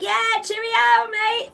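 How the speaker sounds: very high, drawn-out British cheerio; mock British. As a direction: sing out a hammed-up British reply. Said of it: "act like I was fucking British the whole time".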